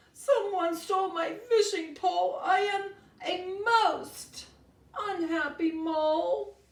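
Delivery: high, loud hammed-up boo-hoo sobbing; sobbing mole. Mole tells Joey her pole was stolen, loud mock sobbing. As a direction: wail like a heartbroken little mole. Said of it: "Rosie sobs".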